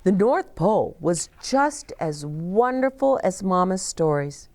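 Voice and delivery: usual speaking voice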